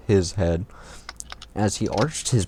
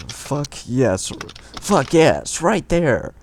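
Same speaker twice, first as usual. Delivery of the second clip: rising, louder hammed-up cries; mock yell; 6 semitones higher, much slower than usual. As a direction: build from mild to loud mock yell. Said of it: "yelled loudly at the other".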